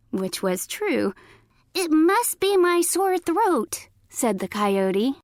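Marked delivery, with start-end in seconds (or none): 1.7-3.9 high fake granny voice; high-pitched granny imitation. A narrator's reading. Coyote excusing his voice to Little Red, fake granny voice. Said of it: "in a high-pitched voice".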